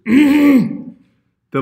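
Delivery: high, loud voice cracking on BLUES; real accidental crack on "Blues". Real, not acted. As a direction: let the voice break upward on "Blues". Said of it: "sorry for my voice crack".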